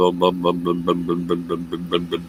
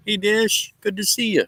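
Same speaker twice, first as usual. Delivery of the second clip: much higher, sing-song greeting to the dogs; doting pet voice. Real, not acted. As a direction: coo at the dogs like babies.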